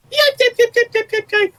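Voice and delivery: high, loud puppy yips; yelping dog. Acted. Storyteller voicing the dog fleeing the fight, high yips. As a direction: yelp like a beaten dog running off.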